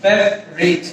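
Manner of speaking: ফেভারিট squeezed to "fev-rit"; correct pronunciation. Shown as a demonstration. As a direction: drop the middle vowel of ফেভারিট, fev-rit. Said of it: "শব্দটা হচ্ছে". (শুদ্ধ উচ্চারণে ফেভারিট, "ফেভ-রিট")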